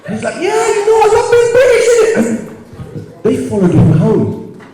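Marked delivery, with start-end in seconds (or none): 0.4-2.1 put-on high, eager Cockney accent; mock Cockney. Uncle playing British to immigration officers. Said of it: "He started becoming really British".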